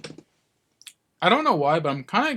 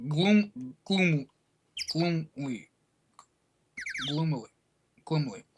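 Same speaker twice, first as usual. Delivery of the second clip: gloomy; 6 dB quieter, slower than usual. Shown as a demonstration. Acting out the word for the viewers, gloomily. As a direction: say it like you're moping at a window. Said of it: "staring gloomily out the window".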